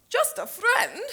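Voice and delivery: high, loud, indignant question; wounded, outraged disbelief; affronted echo. Acted. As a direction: throw the words back, deeply offended. Offended friend echoes the words.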